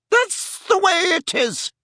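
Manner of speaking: very high, huffy lady's voice; irritated fat lady. Acted. Fat lady refusing to argue with Harry, huffy. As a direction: brush him off in high-pitched indignation.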